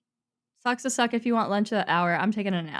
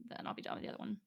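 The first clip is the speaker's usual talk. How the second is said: muttered to self; 18 dB quieter, much faster than usual. Real, not acted. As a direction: mumble it under your breath. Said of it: "like, whisper that you made to yourself".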